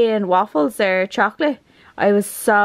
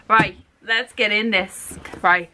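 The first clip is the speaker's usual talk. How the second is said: ordinary talk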